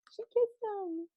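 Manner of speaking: breathless squeal of delight; delighted aside. Real, not acted. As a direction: gush over the kiss. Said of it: "excited story moment".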